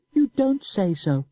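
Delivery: put-on high boy's voice with a sneer; sneering child. A narrator's reading. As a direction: taunt him innocently. Boy mocks, slow.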